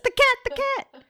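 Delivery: high-pitched mock alarm cry; playful, high cat-sighting yell; mock shouting. Shown as a demonstration. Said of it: "I'll keep shouting throughout the podcast".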